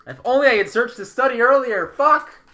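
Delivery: high, loud mock lament; exasperated outburst. Player regretting an unsearched study, exasperated outburst. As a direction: cry out in exaggerated regret, ending on a shouted curse.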